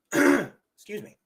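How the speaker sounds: normal talking voice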